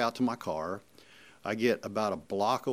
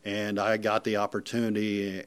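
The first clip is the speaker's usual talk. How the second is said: usual speaking voice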